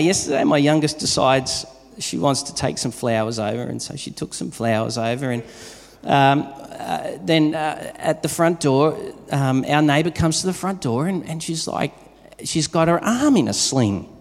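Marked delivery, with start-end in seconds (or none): none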